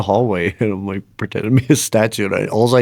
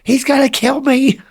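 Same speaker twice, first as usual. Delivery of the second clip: high-pitched, hammed-up panic; mock terrified wail. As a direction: wail it like a guest convinced of doom. Imitating the panicked guest again.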